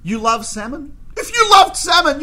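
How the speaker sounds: high and loud, shouting love; outraged shout. Retold or imitated. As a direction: blast the hypocrisy at him. Waiter's climactic outburst.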